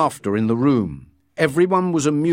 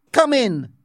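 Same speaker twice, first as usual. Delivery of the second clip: ordering; 12 semitones higher, 3 dB louder than usual. A narrator's reading. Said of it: "he ordered".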